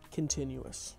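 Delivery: ordinary talk, nothing marked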